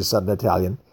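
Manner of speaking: ordinary delivery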